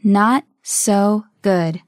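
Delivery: very slow, spaced words; slow model. Shown as a demonstration. Teacher modelling a phrase for learners, very slowly. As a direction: leave gaps between the words.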